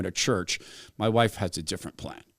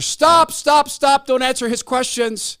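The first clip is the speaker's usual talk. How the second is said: high, loud frantic shout of stop; yelling across a square; 12 semitones higher, 8 dB louder, slower than usual. Retold or imitated. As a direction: yell to stop him from across the plaza. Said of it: "yells from across Union Square".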